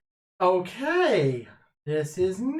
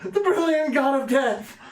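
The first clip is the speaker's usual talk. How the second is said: panicked shout; 7 semitones higher than usual. Acted. Character startled by blade again.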